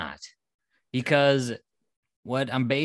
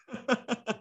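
high, softer laugh; leftover giggle; 10 semitones higher, 5 dB quieter than usual. Real, not acted. Host just kidding about the truck question, giggling. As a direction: keep giggling, high and light. Still laughing at own joke.

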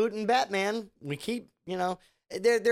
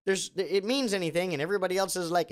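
ordinary talk

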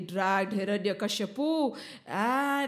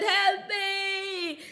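high, drawn-out wail; yelled cry for help; 9 semitones higher than usual. A narrator's reading. Demon king being torn open, wailing for help. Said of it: "Hiranyakashipu yelled".

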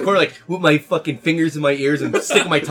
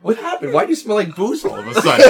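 suspicious wife; 8 semitones higher, 7 dB louder than usual. Pretend. Voicing wife questioning possessed husband.

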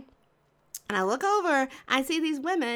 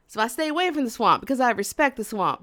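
usual speaking voice

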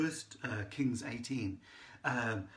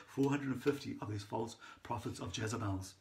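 ordinary talk

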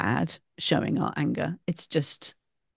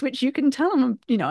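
normal talking voice

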